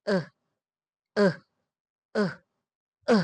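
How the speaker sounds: ا held as a relaxed uh; drawn out schwa vowel ا; schwa demo. Shown as a demonstration. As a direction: say ا from the throat, lips loose. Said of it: "ہم گلے سے آواز یہ نکالیں گے".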